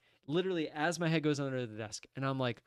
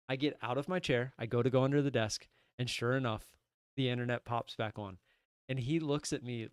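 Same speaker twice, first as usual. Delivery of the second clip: ordinary talk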